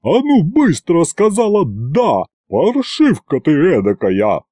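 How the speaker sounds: slow, high-pitched scolding; bossy wail, dropping at the end; scolding holler. A narrator's reading. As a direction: drag out each word of the telling-off. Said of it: "завопила Агриппина Падловна из первого ряда".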